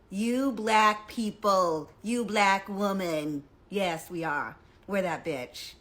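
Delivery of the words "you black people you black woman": slow, loud, proud reclaiming; proud declaration. Retold or imitated. Answering commenters, slow loud pride. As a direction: turn the insult into a boast.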